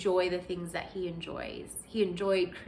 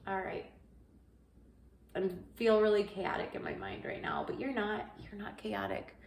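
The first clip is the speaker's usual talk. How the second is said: prayer; 3 dB louder than usual. Retold or imitated.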